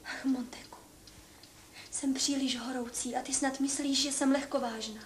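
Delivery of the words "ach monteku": breathy whispered sigh. Acted. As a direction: sigh the name like Juliet. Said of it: "Juliet's sigh on stage". (Julie šeptá jméno)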